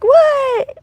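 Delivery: very high-pitched, disbelieving yelp; incredulous. Retold or imitated. Woman hearing Jesus claim time surfing, incredulous. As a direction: re-enact being thrown by an absurd claim.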